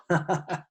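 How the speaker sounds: short acted chuckle; old man's chuckle. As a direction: chuckle knowingly like an amused old man. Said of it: "the old man just chuckled".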